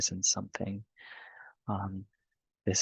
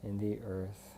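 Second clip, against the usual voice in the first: guided meditation; 12 dB quieter than usual. Real, not acted. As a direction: lead them gently through the breathing, unhurried.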